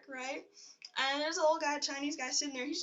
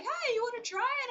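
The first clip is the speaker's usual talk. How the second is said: high, louder imitation of an eager invite; friendly invitation; 11 semitones higher, 5 dB louder than usual. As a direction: call out a friendly offer, imitating. Friendly old men inviting her.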